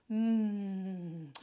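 long, low, soft "mmm" of relish; hungry hum. Acted. Dragon eyeing the witch for tea, low hungry hum. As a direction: hum as if licking your lips.

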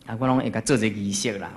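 ordinary delivery

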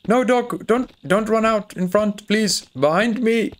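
high-pitched plea to a dog; urgent calling. Real, not acted. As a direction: call the dog back, voice pitched right up. Urgently calling runaway dog back.

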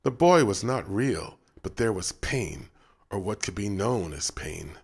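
ordinary delivery, nothing marked

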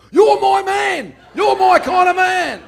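slow, high, full-voiced shout; roaring approval. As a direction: bellow it like a thrilled bloke at the bar. Pub bloke's roaring approval.

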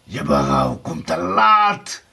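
slow, low villain's roar; raging. A narrator's reading. Blauwbaard rejects Erika's remorse, raging. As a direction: draw it out, swelling with rage. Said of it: "tierde Blauwbaard".

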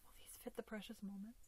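quick hushed whisper. Real, not acted. Trying tiny clothes on a doll, quick whisper. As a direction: whisper the question to yourself.

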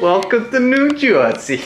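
a hammy New Jersey welcome; mock New Jersey accent. Greeting visitors in a mock New Jersey accent. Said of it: "can you do a New Jersey accent".

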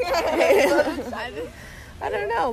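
laughing through the words. Real, not acted. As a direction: giggle out the words.

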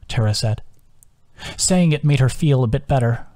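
ordinary delivery

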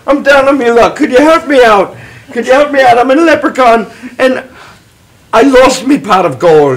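put-on Irish accent. Down-on-his-luck leprechaun asks for help, in Irish accent. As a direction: beg for help in a stage Irish lilt. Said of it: "I can do an Irish voice".

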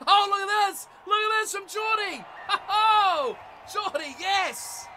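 high, loud excited shout with laughter; thrilled shouting. Real, not acted. Gamer cheering Geordie's run in the game, thrilled. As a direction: whoop and cheer the player on.

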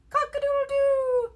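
high-pitched crow; rooster crow. A narrator's reading. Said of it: "He can sound like a rooster".

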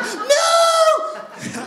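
very high, loud laughing scream. Retold or imitated. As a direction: scream it like the ride just dropped. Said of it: "the guy who I was with screamed".

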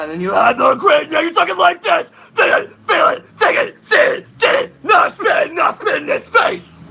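amplify your talking voice into a roaring scream; aggressive mid scream. Shown as a demonstration. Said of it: "an aggressive talk almost".

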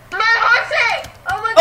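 excited shout. Real, not acted. Excited shout about horse.

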